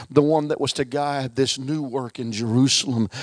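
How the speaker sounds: ordinary delivery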